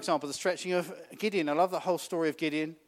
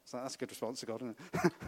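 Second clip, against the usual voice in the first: usual speaking voice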